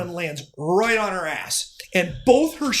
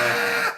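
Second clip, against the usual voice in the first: mock wail; 14 semitones higher than usual. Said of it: "she cried twice as loud and twice as hard".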